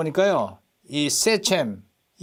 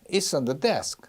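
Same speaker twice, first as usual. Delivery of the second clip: "on" light and quick, weight falls on "desk"; unstressed "on"; much slower than usual. Shown as a demonstration. Teacher contrasts the preposition, "on" left unstressed. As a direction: glide over the word "on". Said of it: "on에 강세가 안 들어가잖아요".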